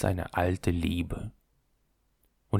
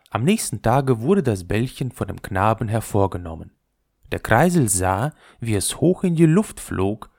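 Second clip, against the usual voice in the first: usual speaking voice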